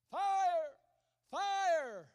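high, loud alarm shout; shrill cry of fire. Acted. A voice on the burning ship screaming an alarm. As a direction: scream the warning across the deck. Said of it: "they heard a shrilling cry".

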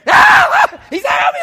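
very high, shrieked mock terror; little scaredy screaming. Man with rat climbing his boots, comic scared screaming. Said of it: "screaming like a woman or something".

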